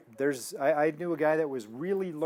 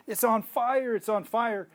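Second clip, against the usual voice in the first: high, louder imitation of amazed shouting; excited exclamation; 8 semitones higher, 5 dB louder, slower than usual. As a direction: exclaim it like a man just healed. Voicing healed man running around.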